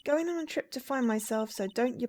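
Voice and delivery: put-on innocently puzzled young girl; sweet, earnest girl character. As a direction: play a naive little helper.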